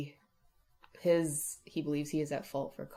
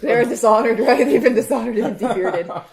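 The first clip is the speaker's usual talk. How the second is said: very loud, high outburst breaking into laughter; hammed-up outcry, laughing at the end; mock outraged shout; 7 semitones higher, 16 dB louder than usual. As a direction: yell the joke over everyone. Player joking over the game master.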